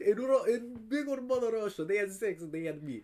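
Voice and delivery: put-on Russian accent, starting high and loud; mock Russian accent. Joining co-host's Mother Russia joke, mock Russian accent. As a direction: ham up a Russian storyteller.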